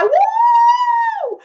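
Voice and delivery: high, loud whoop of joy; excited woo. Retold or imitated. Audience hyped about the Africa trip, loud high whoop. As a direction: let out a thrilled high woo. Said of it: "we like".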